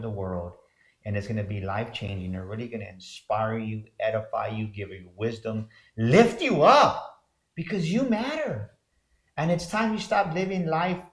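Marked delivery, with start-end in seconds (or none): 6.0-11.0 emphatic uplift. Real, not acted. Rallying the audience in a promo.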